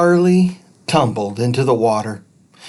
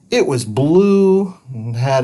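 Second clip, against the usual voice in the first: usual speaking voice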